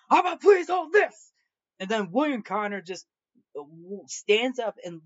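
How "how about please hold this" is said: much higher and louder, hammed-up sneer; mock him by throwing his words back; furious mocking echo. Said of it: "says back to the bar patron".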